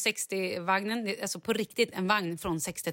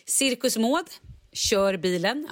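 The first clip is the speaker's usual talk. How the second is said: ordinary delivery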